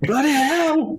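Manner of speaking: a mum fed up with her son, mock exclaiming bloody hell; imitating mum. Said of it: "She's like".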